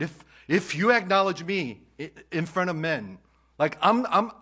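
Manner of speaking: loud, high, urgent preacher; impassioned preaching. Real, not acted. Pastor confessing before the congregation, impassioned preaching. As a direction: press it home with fire.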